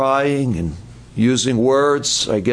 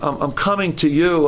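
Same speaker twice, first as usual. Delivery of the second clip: normal talking voice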